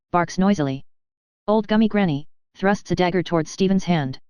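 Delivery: ordinary delivery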